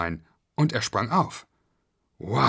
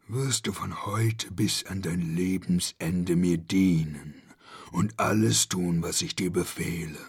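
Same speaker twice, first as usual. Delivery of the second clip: low, slow, sinister monster voice; commanding monster; 5 semitones lower, slower than usual. Acted. The monster orders zombie Schnuffel to serve it, low and slow. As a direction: command your new servant like a beast. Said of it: "befahl das Ungeheuer".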